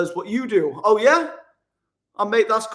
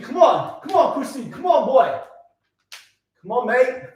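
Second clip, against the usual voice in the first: loud, higher come-ons before the burpees; self pep talk; 6 semitones higher, 4 dB louder than usual. Real, not acted. Pumping himself up for the workout, loud and raised. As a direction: gee yourself up to start.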